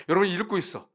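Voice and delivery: ordinary talk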